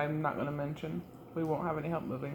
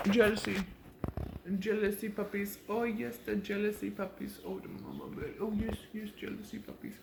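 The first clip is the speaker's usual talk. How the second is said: talking to a dog; 4 semitones higher than usual. Real, not acted. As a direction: tease the dogs sweetly. Cooing to dogs.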